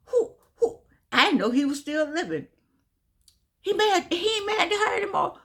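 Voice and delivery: high, shocked mock gossip; gossiping relative. Relative gossiping about who shows up, high and shocked. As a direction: gasp it out like a nosy cousin.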